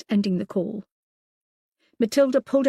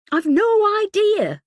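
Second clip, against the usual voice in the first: high, loud, slow call; 14 semitones higher, 9 dB louder, slower than usual. A narrator's reading. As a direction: call it out from across the room. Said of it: "Matilda called out".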